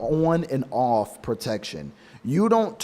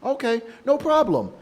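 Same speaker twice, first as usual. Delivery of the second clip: fake easygoing; 9 semitones higher than usual. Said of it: "you go like".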